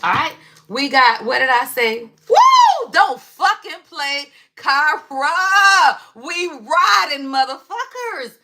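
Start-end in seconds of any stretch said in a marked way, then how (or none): 2.3-2.9 a very high, loud whoop; excited whoop. Real, not acted. Hyping up her live viewers with a shrieking woo. 2.9-8.4 hyped. Real, not acted. Hyped rant at viewers.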